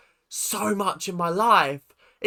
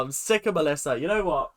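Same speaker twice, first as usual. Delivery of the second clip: normal talking voice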